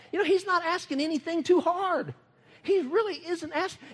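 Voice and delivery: high, loud preacher emphasis; preaching. Real, not acted. Preacher urging the congregation to commit. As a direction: lift it up to the crowd.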